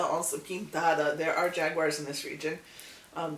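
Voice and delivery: usual speaking voice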